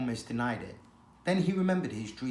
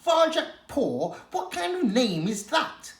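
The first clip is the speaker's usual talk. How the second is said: high, slow mocking incredulity on Varjak; scornful disbelief; 10 semitones higher, 4 dB louder than usual. She-cat scoffs at his fancy name, high and slow.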